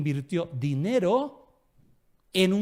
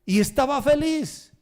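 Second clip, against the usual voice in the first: much higher and louder, stretched out slowly; happy; 9 semitones higher, 6 dB louder than usual. Retold or imitated. Pastor recalls the robbed man, high and delighted. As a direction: beam it out like pure joy.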